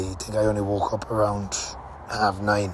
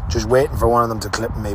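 ordinary talk

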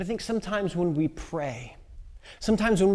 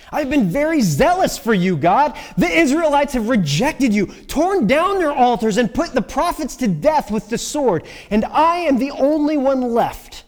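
loud, higher-pitched, impassioned protest; frustrated complaint; 7 semitones higher, 9 dB louder than usual. Acted. Elijah answering God at the cave, frustrated. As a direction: pour out your grievance to God at full voice.

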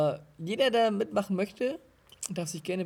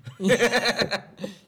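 laughter; 4 semitones higher, 7 dB louder than usual. Real, not acted. Laughing while sniffing drink.

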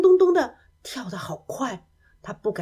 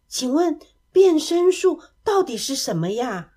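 higher, louder curious ask; eager question; 5 semitones higher, 5 dB louder than usual. A narrator's reading. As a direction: ask with sudden keen interest. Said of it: "突然眼睛亮了起来". (眼睛一亮地好奇追问)

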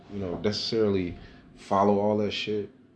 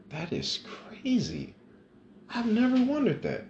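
amazed. Real, not acted. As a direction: marvel at a thought you never had. Marvelling at own thought.

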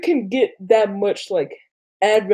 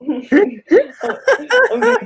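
laughing through words; 11 semitones higher, 4 dB louder, much slower than usual. Real, not acted.

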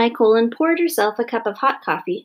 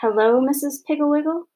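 higher, plaintive greeting; sad and ashamed; 6 semitones higher than usual. A narrator's reading. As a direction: greet her sheepishly, a touch mournful. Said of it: "so sad and ashamed".

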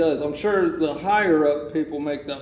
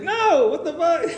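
much higher, incredulous; mock outrage; 12 semitones higher, 3 dB louder than usual. Caller refusing the scammer, incredulous high outburst. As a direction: act stunned and indignant. Speaker's own outraged reply retold.

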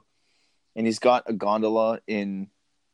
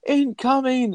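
high, mock warning cry from Mrs. Claus; Mrs. Claus yelling; 4 dB louder, slower than usual. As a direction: shriek it like a panicked old lady. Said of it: "you just hear Missus Claus go".

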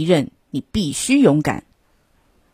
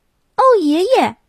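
high little toad exclamation; excited, high-pitched call; admiring cry; 11 semitones higher, 3 dB louder than usual. A narrator's reading. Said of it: "他叫道".

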